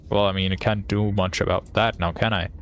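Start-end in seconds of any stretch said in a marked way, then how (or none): none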